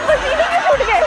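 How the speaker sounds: teasing surprise. Real, not acted. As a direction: tease him for sweating. Teasing the sweating guy.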